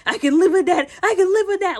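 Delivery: high, loud, insistent mimicry; mocking imitation. Laughing at him convincing himself, mocking imitation. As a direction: mimic him overdoing his self-assurance. Mocking his repeated line.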